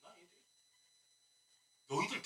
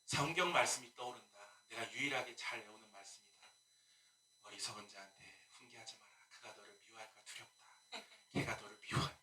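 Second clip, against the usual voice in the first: quiet pastoral advice; 9 semitones lower, 8 dB quieter than usual. Retold or imitated. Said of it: "제가 그런 구역장 불러서".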